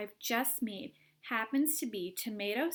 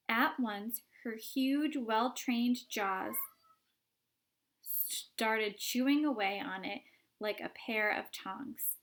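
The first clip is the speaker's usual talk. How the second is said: plain reading voice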